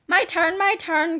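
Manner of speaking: ordinary delivery, nothing marked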